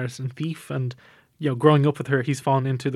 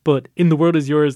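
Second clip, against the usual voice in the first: normal talking voice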